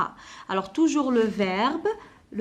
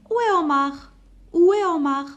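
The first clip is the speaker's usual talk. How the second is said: higher, louder call for Omar; 6 semitones higher, 6 dB louder, faster than usual. Acted. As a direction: call across the house for Omar. Mother calls out for son. (appel plus fort et plus aigu de la mère)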